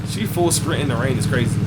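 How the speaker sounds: ordinary talk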